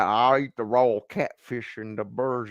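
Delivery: lightly put-on Southern twang; mock Southern accent. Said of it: "he's got the Southern twang, but it's nothing like this guy".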